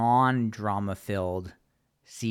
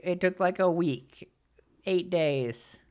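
normal talking voice

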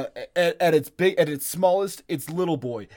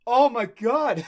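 amazed; 6 semitones higher, 3 dB louder, slower than usual. Real, not acted. Reacting to wild theory.

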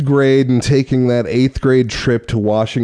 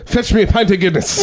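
higher-pitched put-on Irish accent; mock Irish; 6 semitones higher than usual. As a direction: order like a cartoon Irishman. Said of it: "really hard accent to do".

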